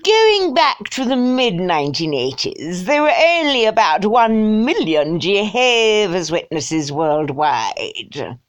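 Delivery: slow, drawling mock upper-class English; hammed-up snobbish English accent; snotty English. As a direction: read it as a snooty English toff, stretched out. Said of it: "I think snotty English".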